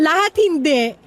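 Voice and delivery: laughing through words. Real, not acted. Teased about being a former man.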